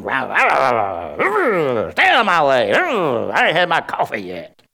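slow, hammed-up grouchy growl; grumping. Mister Grump before his coffee, exaggerated grumpy growl. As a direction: growl each phrase out like a comic morning monster. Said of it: "You get up grumping".

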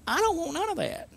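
high, soft mock protest; retold refusal. Teenage boy rejecting gloomy Christians, high-pitched refusal. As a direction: push it up high, keeping the volume down.

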